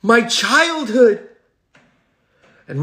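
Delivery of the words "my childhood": mock shock. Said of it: "It's like".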